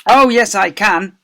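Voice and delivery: slow, loud, high retort; thundering. A narrator's reading. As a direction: thunder it back defiantly. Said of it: "thundered the deputy head".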